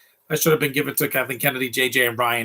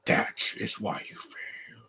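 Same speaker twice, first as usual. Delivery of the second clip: very slow, soft and solemn sage imitation; film-quote imitation; 3 semitones lower, 6 dB quieter, much slower than usual. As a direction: intone it softly, drawing out the last word. Quoting Yoda's line along with film.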